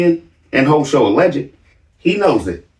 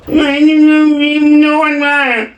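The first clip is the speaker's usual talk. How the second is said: mocking little Ben; 10 semitones higher, 3 dB louder, slower than usual. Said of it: "Did little Ben just go".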